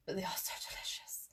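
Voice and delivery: whispered aside about a treat. Real, not acted. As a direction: share it like a delicious secret. Confiding a treat to viewers.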